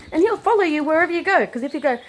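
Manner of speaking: ordinary talk, nothing marked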